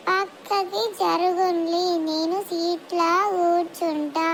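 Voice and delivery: drawn-out, very slow words; very slow talker. Acted. Slow-talking girl asks aunty to shift over, very slowly. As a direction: stretch every syllable, barely pushing. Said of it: "మెల్లే మాట్లాడుతుంది".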